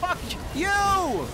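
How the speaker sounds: high-pitched furious yell; angry outburst. Real, not acted. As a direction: yell it high at your opponent. Swearing at the opponent in game.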